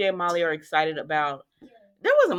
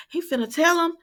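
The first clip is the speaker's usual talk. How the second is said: "He finna tell him" jumps much higher and louder; excited squeal; 11 semitones higher, 5 dB louder than usual. Retold or imitated. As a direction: squeal it as if the big secret is about to come out. Own reaction, said twice.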